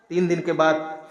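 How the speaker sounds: ordinary delivery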